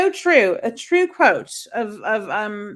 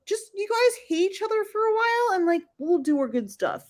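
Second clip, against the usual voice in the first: lightly voiced elite, starting high; mock scheming elite; 8 semitones higher than usual. Elite telling ordinary people to hate each other, mock scheming. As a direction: pitch up, then ease down, as the elite plotting. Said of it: "like, they're like".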